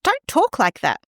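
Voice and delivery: high dismissive parent voice; parent brushing it off. Retold or imitated. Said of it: "and you say".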